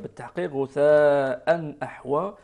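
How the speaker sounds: ordinary talk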